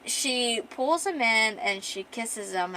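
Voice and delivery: ordinary delivery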